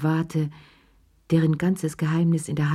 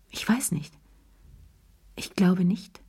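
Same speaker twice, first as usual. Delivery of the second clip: whispered; 3 semitones higher than usual. A narrator's reading. Wife unsure under husband's questions.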